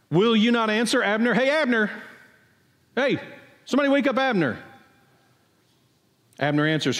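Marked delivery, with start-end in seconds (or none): 0.1-4.6 calling out high, as if across a valley to the sleeping camp; raised call to wake the guard; shouting across the valley. Acted. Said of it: "he begins to shout".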